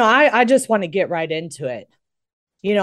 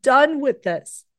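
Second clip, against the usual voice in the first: high, strained tearful outburst, pitch well up; retold crying son; 6 semitones higher than usual. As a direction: imitate her son near tears, fed up. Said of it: "then he started bawling".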